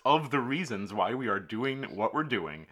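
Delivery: slow, deliberate Spock-style lecture; slow deliberate explaining. Retold or imitated. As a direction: spell it out slowly and precisely. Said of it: "turns to him and says".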